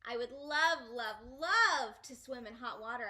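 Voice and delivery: put-on loud, eager child; excited gush. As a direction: gush about the hot water. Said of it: "I said".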